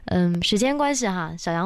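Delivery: ordinary talk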